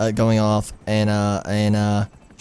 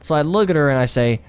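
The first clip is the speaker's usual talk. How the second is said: ordinary talk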